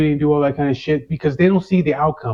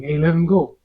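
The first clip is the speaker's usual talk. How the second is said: a retold call, raised and a bit higher; yelling from down the street; 4 semitones higher than usual. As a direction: shout it across the street, only half full. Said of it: "he's yelling".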